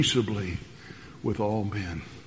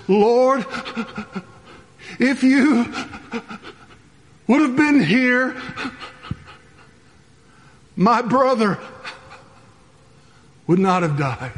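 higher, halting mock sobbing plea; imitating Mary weeping; 8 semitones higher than usual. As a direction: play grieving Mary, words broken by tears. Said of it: "That's probably a poor imitation on my part".

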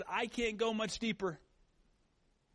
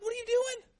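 startled dad; 5 dB louder than usual. Retold or imitated. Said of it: "I'm like".

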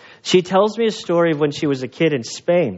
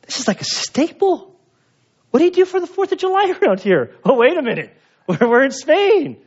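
high, fast rush of disbelief, then realizing; baffled American; 12 semitones higher than usual. Retold or imitated. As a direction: catch himself mid-rant. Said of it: "is going".

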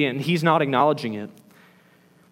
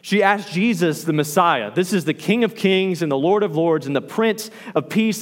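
usual speaking voice

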